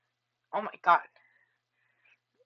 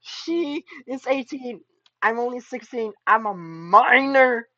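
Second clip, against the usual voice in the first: louder, slowed, high emphatic complaint; loud mock protest; 7 semitones higher, 16 dB louder, slower than usual. Streamer joking loudly about the character's age. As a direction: drag the words out in hammed-up outrage.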